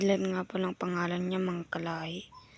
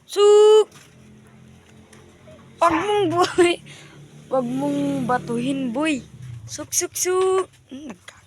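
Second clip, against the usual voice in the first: high, loud pigeon call with a short scold; 9 semitones higher, 10 dB louder, slower than usual. Real, not acted. Owner calling his pigeons while warning a pest, high and loud. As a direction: coax the birds down, then snap at the thrower.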